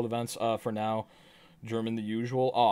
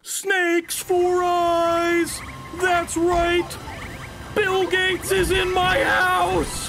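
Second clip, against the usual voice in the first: very high, slow cartoon squeal; high, slow, cartoonish; silly squeaky voice; 4 dB louder, much slower than usual. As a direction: stretch it out in a tiny squeaky voice. Goofy high chanted bit.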